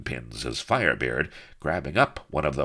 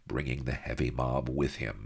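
ordinary delivery, nothing marked

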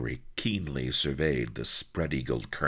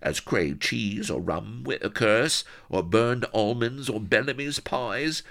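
wistful rustic voice, high then softening; whimpering; 6 semitones higher than usual. A narrator's reading. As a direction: drift from whine into longing reverie. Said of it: "he whimpered".

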